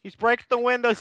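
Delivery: normal talking voice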